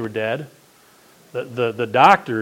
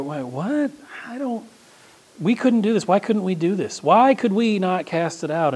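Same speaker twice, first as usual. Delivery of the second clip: lightly voiced confused disciples, pitched up; puzzled disciples; 6 semitones higher than usual. Disciples asking Jesus why they failed, lightly puzzled. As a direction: play the bewildered disciples. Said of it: "we go".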